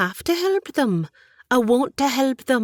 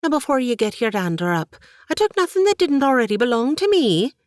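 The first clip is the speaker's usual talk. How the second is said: quick, high, louder indignant protest; 8 semitones higher, 5 dB louder, faster than usual. Acted. Katie defends herself to her aunt, indignant and loud. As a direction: defend yourself, affronted. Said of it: "Katie's indignant tone".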